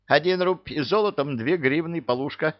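high-pitched servant reading out the bill; obliging vendor. A narrator's reading. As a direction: call out the price up high. Servant figure names the price.

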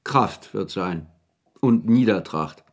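slow, heavy recital of a gloomy poem; reciting verses. Acted. Wetschorowski recites ominous verses to the anxious Maljanow, slow and solemn. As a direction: intone the lines slowly, like a dark prophecy. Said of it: "Dass es sich um Verse handelte".

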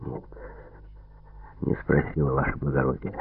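very low, hushed submissive answer; muted, deferential reply; cowed prisoner. A narrator's reading. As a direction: answer your interrogator meekly, deep and soft.

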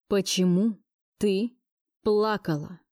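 "Почему... ты... плакала", firm, a pause after each word; each word separated. A narrator's reading. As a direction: harshly set apart "Почему", "ты", "плакала". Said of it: "жёстко повторил он, выделяя каждое слово". (жёстко, раздельно: «Почему. Ты. Плакала?»)

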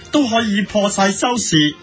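ordinary delivery

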